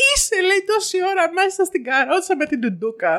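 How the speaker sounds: high, urgent explaining; aghast sister, acted. Sister telling her mother the megaphone was on, aghast retelling. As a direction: spell out the disaster, imitating her.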